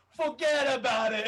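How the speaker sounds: loud, high, drawn-out New York shout; New York accent. Retold or imitated. Man calling after she leaves, loud New York accent. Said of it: "it's very New Yorker".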